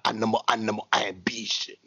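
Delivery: low, hushed mock Nigerian accent; Apollo Crews. Host mimics wrestler's Nigerian voice, low and whispery accent. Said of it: "that's what he was sounding like".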